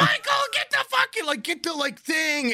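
very high mock shriek easing off; frantic shout. Stewardess orders Michael to fetch something, frantic shout. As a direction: imitate a panicked order, screeched then fumbled. Stewardess yells for her colleague.

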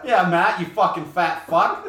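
mock yell. Mock insult between friends.

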